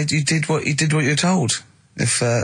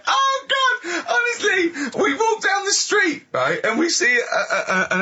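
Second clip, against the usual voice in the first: laughing; slower than usual. Real, not acted. Host in fits at theory.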